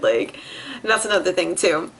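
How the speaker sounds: usual speaking voice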